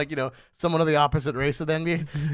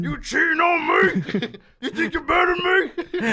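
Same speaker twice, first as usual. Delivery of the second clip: high, loud, drawn-out mock Southern shout; hammed-up Southern husband in a slow, high yell; heavy Southern accent; 12 semitones higher, 4 dB louder, slower than usual. Husband accusing his wife, shouted mock Southern accent. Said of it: "with a very heavy Southern accent".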